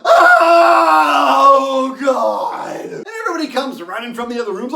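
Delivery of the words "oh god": long, loud, high mock yell; retold scream. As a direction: hold the scream out in terror. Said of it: "he just sort of finished the scream".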